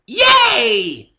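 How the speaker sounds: very high, very loud squeal; a thrilled pony cheering; squealing yay. A narrator's reading. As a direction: squeal with pure delight. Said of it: "she squealed".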